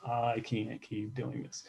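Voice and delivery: soft, slow, worn-out reading of his own note; quietly reading journal. Retold or imitated. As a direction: read the note back quietly, slowly, like it still hurts. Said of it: "I read notes in here".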